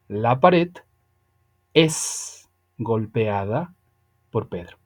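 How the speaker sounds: slow, spaced example sentence; ejemplo lento. Shown as a demonstration. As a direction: read it slowly with pauses, like a model sentence. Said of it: "una voz pasiva suena de esta manera". (ejemplo en voz pasiva, dicho despacio y con pausas)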